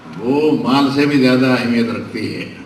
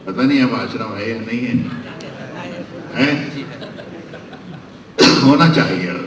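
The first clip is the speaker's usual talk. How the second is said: ordinary delivery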